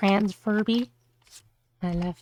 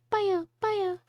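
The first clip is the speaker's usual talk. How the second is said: higher squeaky baya babble; Kirby noise; 11 semitones higher than usual. Shown as a demonstration. Trying out the Kirby voice, high babble. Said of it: "Just making Kirby noises".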